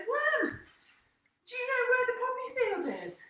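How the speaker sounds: high-pitched storybook bee voice; bee calling out. Acted. Bee asks the worm about the poppy field, calling out high. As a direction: call out high, like a little bee.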